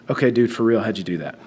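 low, hushed question; confidential aside. Retold or imitated. Confides to classmate during class.

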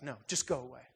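low, soft, turning away; withdrawn refusal. Retold or imitated. Hiding woman sends the spirit away, low and soft. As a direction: push him off quietly. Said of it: "And she's like".

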